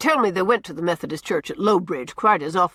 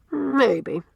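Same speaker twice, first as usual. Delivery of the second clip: first maybe set higher, falling; thoughtful maybe; 7 semitones higher than usual. A narrator's reading. Cornelia muses on Anne's words, raised thoughtful maybe. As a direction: Cornelia half agreeing, first maybe up high. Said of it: "assented Miss Cornelia".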